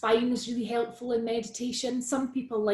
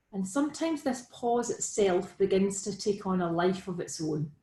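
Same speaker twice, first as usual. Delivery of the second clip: guided meditation. Real, not acted. Guiding listeners into Be With The Breath, calm meditation delivery. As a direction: lead them gently through it, leaving room to breathe.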